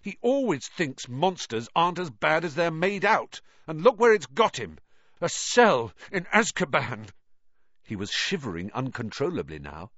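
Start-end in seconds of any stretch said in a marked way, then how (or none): 0.0-7.1 raised, heated outburst; frustrated rant, getting louder; angry. A narrator's reading. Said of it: "thumping the wall of the cabin".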